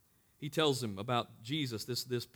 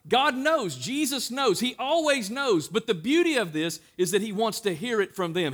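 loud, high, driving sermon delivery; full-force preaching; 6 semitones higher, 7 dB louder than usual. Acted. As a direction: preach it out to the whole room.

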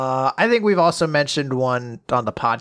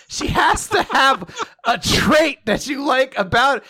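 high, slow frustrated shout; exasperated shout; 8 semitones higher, 4 dB louder, slower than usual. Retold or imitated. Fed up with Taiyo, shouting in frustration. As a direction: yell it out, fed up.

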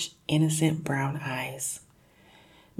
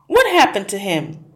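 spluttering; 4 semitones higher, 7 dB louder than usual. Acted. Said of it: "he spluttered".

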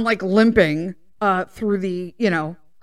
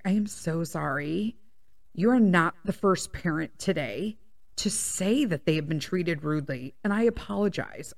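lower, softer, earnest apology; serious apology; 4 semitones lower, 8 dB quieter than usual. Retold or imitated. Young coach apologizing to an upset parent, serious and soft. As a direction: imitate a sincere, measured apology. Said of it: "really serious and she was like".

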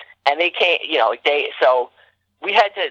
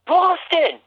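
loud excited shout. Retold or imitated. Kid contestant shouting the answer for the TV cameras, high excited yell. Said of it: "I say as loud as I can".

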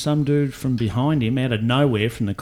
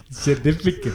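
put-on laughing South African accent; South African accent through a laugh; 5 semitones higher than usual. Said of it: "in your South African accent".

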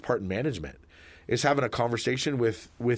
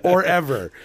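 higher, louder punchline; emphatic loud add-on; 6 semitones higher, 7 dB louder than usual. Real, not acted. Topping the cohost's joke, loud and higher. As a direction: belt the topper.

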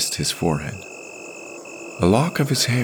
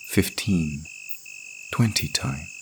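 plain reading voice

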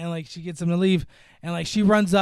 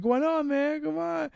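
playful pressing; 10 semitones higher than usual. Retold or imitated. Mechanic pressing a reluctant crush, coaxing. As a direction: wheedle like a pushy admirer. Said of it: "starts pressing it".

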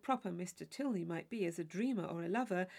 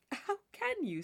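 laughing; 12 semitones higher than usual. Acted.

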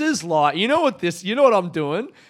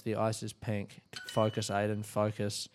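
soft, low muttered self-coaching; 6 semitones lower, 11 dB quieter than usual. Real, not acted. Aiden quietly urging himself on, self-coaching. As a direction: tell yourself to focus under your breath. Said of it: "self-talk".

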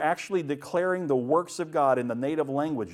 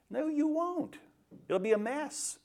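much higher, drawn-up voice; teasing contradiction; 13 semitones higher than usual. Acted. Preacher deflating a young pastor's confidence, high teasing contradiction. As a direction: burst the bubble in a high voice.

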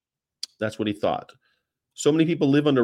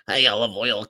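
Popeye voice; 4 semitones lower than usual. Retold or imitated. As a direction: call out like a cartoon sailor. Popeye call repeated.

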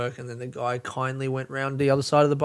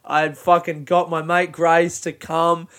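ordinary delivery

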